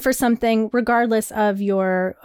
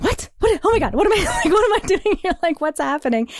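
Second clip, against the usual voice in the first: higher, laughing panic; shocked disbelief; 6 semitones higher than usual. Retold or imitated. Retelling her shock at the organizer's warning, laughing panic. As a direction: squeal a laughing what am I doing here. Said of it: "And I was like".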